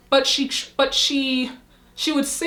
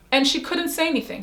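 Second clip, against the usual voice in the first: ordinary talk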